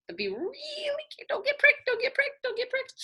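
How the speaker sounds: high squeaky repeated warning; careful warning. Real, not acted. Storyteller warns children not to get pricked, high squeaky voice. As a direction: tiptoe worried voice.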